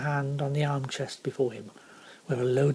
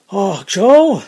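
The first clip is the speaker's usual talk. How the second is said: loud, raised call of warning; warning cry; 4 semitones higher, 11 dB louder than usual. A narrator's reading. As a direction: cry out to alert your mate. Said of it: "cried the coachman in a warning voice".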